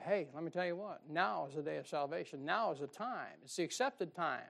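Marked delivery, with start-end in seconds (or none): none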